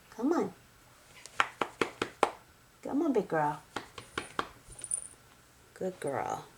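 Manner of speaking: soft, hushed comforting of the cat; soothing pet talk. Real, not acted. Owner comforting her cat who lost Mom, soft and tender. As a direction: settle her down gently and quietly.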